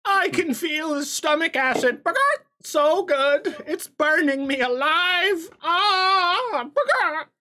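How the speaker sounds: very high, loud squealing chicken; ecstatic chicken voice. Acted. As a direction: squeal with burning delight and cluck. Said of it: "He's like".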